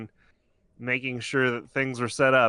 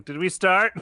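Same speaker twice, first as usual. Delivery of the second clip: higher, louder replay of being caught unaware; mock clueless question; 7 semitones higher, 5 dB louder than usual. Host replaying his own cold open, mock clueless and pitched up. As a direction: imitate yourself blurting it, pitched up. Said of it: "It just cuts to me going".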